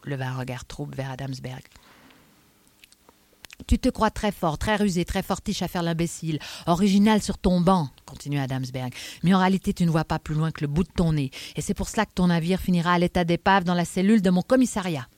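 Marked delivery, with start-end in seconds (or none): 3.7-7.9 exasperated inspector, louder than the narration; scornful Adamsberg. Acted. Inspector taking a street eccentric down a peg, scornful. 9.2-15.1 exasperated inspector, louder than the narration; scornful Adamsberg. Acted. Inspector taking a street eccentric down a peg, scornful.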